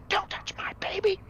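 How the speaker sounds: high, hissing ghostly warning; angry ghost whisper. Acted. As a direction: warn them off in a spectral whisper. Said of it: "But she is angry. She says".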